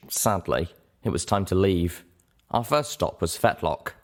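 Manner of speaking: plain reading voice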